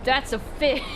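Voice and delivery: breaking into laughter; words dissolve into a giggle; laughing. Real, not acted. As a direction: crack up mid-phrase. Cracks up at the fish.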